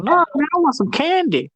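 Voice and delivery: put-on high, whiny little kid begging for candy; a kid pestering for candy, high and whiny. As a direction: pitch way up like a bratty kid. Kid voiced in plot retelling.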